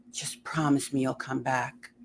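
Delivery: hushed worried plea; soft plea. Acted. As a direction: beg gently under the breath. Boy pleading softly.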